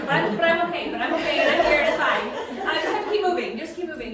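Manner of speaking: loud, high, laughing pep talk to herself; frantic self-reassurance. Retold or imitated. Teacher telling friends about her hectic day, frantic self-reassurance.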